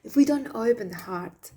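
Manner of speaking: usual speaking voice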